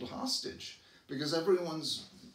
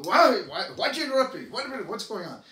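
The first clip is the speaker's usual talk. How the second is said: loud, higher, indignant outburst at being interrupted; flying off the handle; 8 semitones higher, 10 dB louder, faster than usual. Retold or imitated. Interrupted mid-task, snapping back at the interrupter, loud and indignant. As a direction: snap back hard at whoever cut in. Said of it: "we fly off the handle if".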